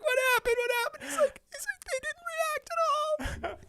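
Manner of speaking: very high, whiny lament; mock wailing. Imitating David wailing after the fans' reaction, very high. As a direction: whine like a let-down kid. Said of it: "he'd be like".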